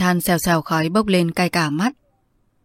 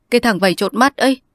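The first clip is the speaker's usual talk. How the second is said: raised, louder tearful speech on 'mắt'; crying, voice lifted on 'mắt'; 5 semitones higher, 4 dB louder, faster than usual. Acted. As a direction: blurt it out through tears. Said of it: "John khóc rừng rức".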